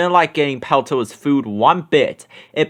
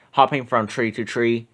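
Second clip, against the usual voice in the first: ordinary delivery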